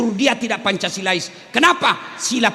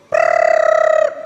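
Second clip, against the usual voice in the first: rolled-lip trill like a break bell; unvoiced lip trill for the break bell; imitated school bell trill; 5 dB louder than usual. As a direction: buzz out the bell sound.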